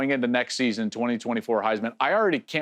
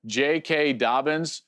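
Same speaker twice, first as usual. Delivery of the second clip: ordinary talk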